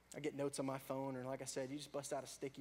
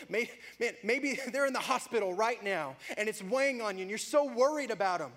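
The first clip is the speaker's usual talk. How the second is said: higher, louder preaching; 6 semitones higher, 10 dB louder than usual. Acted. Preacher urging listeners to bring worries to God, fervent. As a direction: press it on them with urgency.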